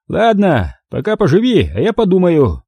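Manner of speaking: high, condescending thug voice; smug thug. Acted. Thug smugly lets the narrator live for now. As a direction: grant mercy like a boss.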